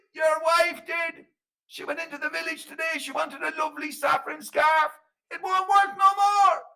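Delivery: high, shouted accusation; accusing fairy man. Acted. Fairy man blames Flanagan's wife for the scarf, shouting high. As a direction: lay the blame in a loud high voice.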